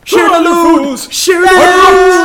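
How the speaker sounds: high, loud quoted warning; hammed-up yell from an adventure film; mock film shout.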